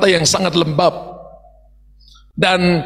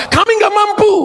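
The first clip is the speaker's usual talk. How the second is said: pleading cry; 12 semitones higher than usual. Retold or imitated. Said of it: "datang dan berkata".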